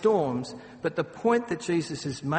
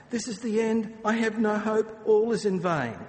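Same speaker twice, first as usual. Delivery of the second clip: mild despair; 6 semitones higher, 4 dB louder than usual. Retold or imitated. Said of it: "that's how they were responding".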